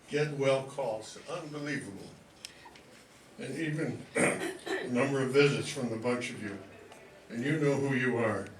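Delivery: normal talking voice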